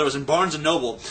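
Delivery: usual speaking voice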